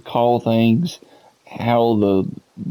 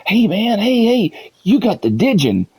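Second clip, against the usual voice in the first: high-pitched mock eager gamer; pestering player imitation; 11 semitones higher than usual. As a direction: imitate an excited player calling for attention. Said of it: "come up to me all the time and be like".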